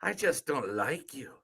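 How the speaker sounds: understated Irish film voice; Irish accent. Acted. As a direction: quote it as an Irish character. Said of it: "Thank you for doing the Irish accent".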